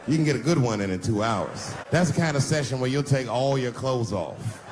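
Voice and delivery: usual speaking voice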